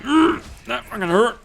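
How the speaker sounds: high, loud yelp of retold pain. Patient telling how the doctor opened his cut, high loud pained yelp. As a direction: cry out as the wound is pulled open.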